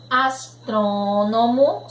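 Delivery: "Astrônomo" slowly, with a long closed ô; closed vowel. Shown as a demonstration. Teacher reading along with pupils, "Astrônomo" slow with closed ô. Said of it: "som fechado".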